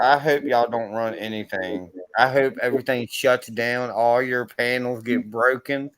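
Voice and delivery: ordinary delivery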